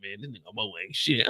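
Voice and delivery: low dismissive heckler; deep mocking chat voice. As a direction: drop low like a sneering heckler. Voicing the chat insulting him.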